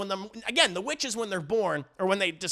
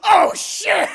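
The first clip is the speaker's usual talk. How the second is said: high, loud cry of alarm; alarmed shout; 13 semitones higher, 11 dB louder than usual. Retold or imitated. Acting Mommy facing the giant monster, alarmed shout. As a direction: yelp it in hammed-up panic.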